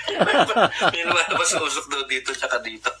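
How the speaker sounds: laughing through the words; can barely get it out for laughing; giggling while retelling the smoke joke. Real, not acted.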